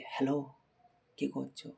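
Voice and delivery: disguised soft phone voice; speaking softly. Retold or imitated. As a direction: keep it soft and hushed on the phone. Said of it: "আমি আস্তে আস্তে কথা বলছি".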